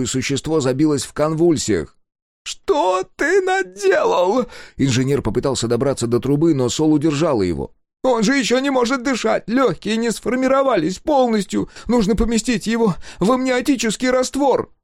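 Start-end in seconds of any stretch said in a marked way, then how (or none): 2.4-4.5 very high, slow, anguished cry; horrified engineer. A narrator's reading. Engineer seeing the tube smashed, very high and horrified. 8.0-14.7 high, pleading and frantic; desperate engineer. A narrator's reading. Engineer pleading with Sol for the creature, high and frantic.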